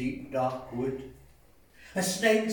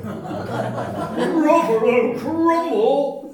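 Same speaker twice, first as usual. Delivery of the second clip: slow, loud drawn-out disbelief; appalled Gruffalo; 8 semitones higher, 12 dB louder, much slower than usual. Acted. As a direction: stretch it out in horror. Gruffalo alarmed.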